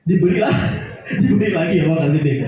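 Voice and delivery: fast, low words through laughter; amused, rushed laughing retelling. Real, not acted. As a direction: chuckle while telling the punchline. Laughs at his own punchline.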